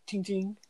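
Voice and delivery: two high ringing tings; high-pitched "ting, ting"; imitated ting. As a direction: imitate a little metallic chime. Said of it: "All you hear is".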